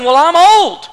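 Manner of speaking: acted exasperated objection; indignant protest. Acted quarrel, Zechariah protesting.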